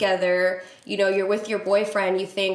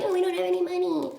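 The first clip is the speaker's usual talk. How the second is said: much higher, sing-song mock of the guys; mocking whiny voice; 10 semitones higher, faster than usual. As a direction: mimic them pathetically. Mocking the men's future excuse.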